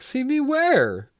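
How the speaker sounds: high, drawn-out mock bewilderment; baffled question. Mimicking a baffled character in recap.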